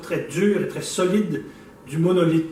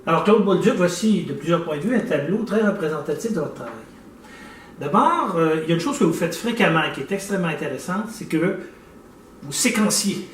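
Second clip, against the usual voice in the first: usual speaking voice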